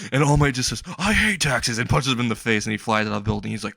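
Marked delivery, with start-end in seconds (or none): 1.0-1.8 higher-pitched mock outburst; sudden pitched-up burst. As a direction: blurt it out in comic rage. Punches him while saying it.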